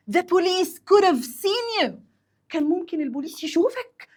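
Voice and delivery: high-pitched acted warning; scolding. Passenger warning friend the police could see, scolding.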